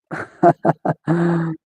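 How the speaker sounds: short soft chuckle. Real, not acted. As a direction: chuckle quietly to yourself. Amused by a chat message.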